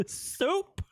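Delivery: high-pitched mock echo; repeating mimicry. Streamer repeating what someone said, high mocking echo. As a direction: parrot the word way up high. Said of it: "Repeating what someone said".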